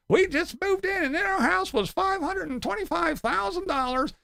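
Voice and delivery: high, prissy complaining voice; mocking homeowners in videos who list every fee, high prissy voice. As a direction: parody new retirees listing their costs. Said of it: "I watch lots of videos of people".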